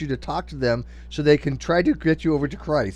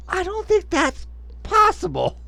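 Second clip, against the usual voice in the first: high incredulous squeak; slower than usual. Real, not acted. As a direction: voice jumps way up in disbelief.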